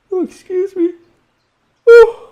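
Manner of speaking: sudden high, loud yelp of surprise; startled outburst. Real, not acted. Teacher startled mid-lesson, loud high surprise. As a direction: jump at something unexpected.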